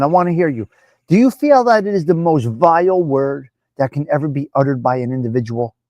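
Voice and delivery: ordinary talk, nothing marked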